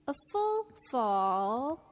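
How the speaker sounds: slow, drawn-out drop on "fall"; full fall. Shown as a demonstration. Narrator names the second pattern, demonstrating a full fall. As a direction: stretch "fall" slowly, sliding all the way down. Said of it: "three intonation patterns".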